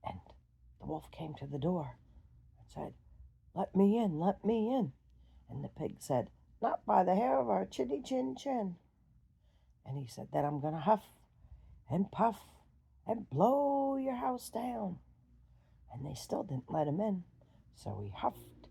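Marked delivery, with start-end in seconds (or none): 3.5-4.9 loud insistent call; demanding wolf. Acted. Wolf at second house. 6.6-8.8 higher, louder pig voice; defiant little pig. Acted. Pig refuses wolf. 10.3-15.0 loud threat, slowing at the end; threatening wolf. Acted.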